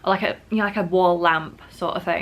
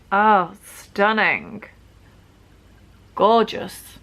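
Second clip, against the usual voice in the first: mock admiration; 2 semitones higher, 5 dB louder, much slower than usual. Real, not acted. Admiring her own nails.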